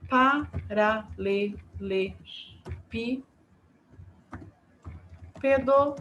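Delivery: "paralelepípedo" very slowly, pa-ra-le-le-pí-pe-do; "paralelepípedo" broken up as for dictation; syllable by syllable. Shown as a demonstration. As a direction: pause after each syllable of "paralelepípedo". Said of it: "vamos contar as sílabas".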